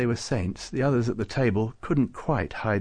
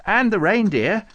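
high, loud, hopeful burst; enthusiastic; 11 semitones higher, 8 dB louder than usual. A narrator's reading. Farouk proposing sainthood for reindeer, enthusiastic. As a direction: chime in eagerly, pitched right up. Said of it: "said Farouk enthusiastically".

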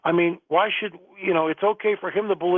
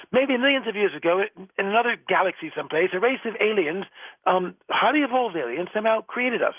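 hammy put-on English accent, higher; mock-posh English accent, imitating Dawkins; bad English accent; 4 semitones higher than usual. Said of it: "bad English accent".

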